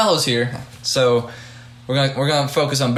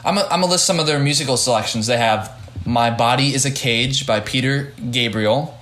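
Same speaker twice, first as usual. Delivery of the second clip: normal talking voice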